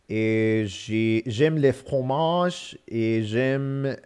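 slow, careful French with an English accent; broken French. Shown as a demonstration. Dictating French to the phone, slow and careful. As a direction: spell it out for the phone in schoolbook French. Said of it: "try French with my broken French".

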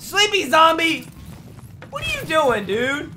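high, loud jeering call; taunting shout. Real, not acted. Gamer taunting a slow enemy, loud jeer. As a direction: holler it at the opponent, drawn out.

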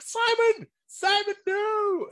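very high, loud, slow hammed-up shriek; dad finding his dog dead, in a high, laughing mock scream; mock horrified scream. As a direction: scream the dog's name through a laugh. Dad's horror, retold.

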